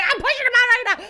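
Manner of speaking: high, fast, loud outburst; frantic yell. Retold or imitated. Mother with nobody there to catch baby, frantic yell. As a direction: blurt it out in acted panic. Mother yelling she is pushing.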